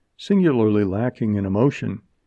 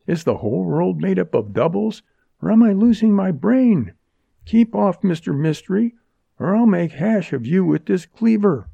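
high-pitched, frantic defiance; alarmed heroine; 9 semitones higher than usual. Acted. Jerry holds off the masked bandit, alarmed and defiant. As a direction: threaten him while losing your bearings.

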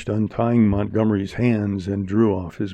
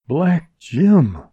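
higher, startled exclamation; name him in sudden fear; frightened gasp; 7 semitones higher, 4 dB louder than usual. A narrator's reading. Jerry recognizes the real bandit, frightened. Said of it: "breathed Jerry, for the first time wholly frightened".

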